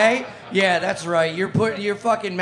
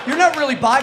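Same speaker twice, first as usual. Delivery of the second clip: not punched, louder and higher; louder accusation; 5 semitones higher, 5 dB louder, faster than usual. Acted. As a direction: hammer it home the second time.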